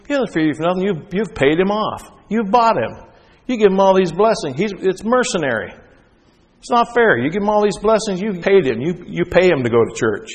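high, loud, indignant accusation; Satan accusing. Acted. Preacher as Satan protesting to God. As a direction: hurl the charge at God like an outraged prosecutor.

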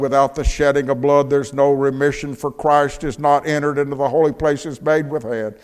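loud, ringing preacher's reading over music; scripture read with force. Acted. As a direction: proclaim the verse to the whole church. Preacher quoting scripture loudly.

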